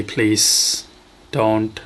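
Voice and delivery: ordinary delivery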